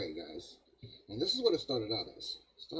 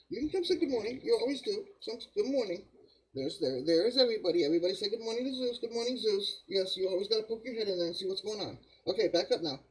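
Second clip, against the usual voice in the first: high sing-song voice for the dog; pet talk; 9 semitones higher, 4 dB louder than usual. Real, not acted. Talking to his dog Zeus.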